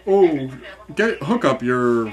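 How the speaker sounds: mock company rep.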